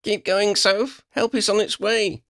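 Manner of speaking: high-pitched encouraging call; high, urgent rallying call. A narrator's reading. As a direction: call ahead to urge her on. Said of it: "she called".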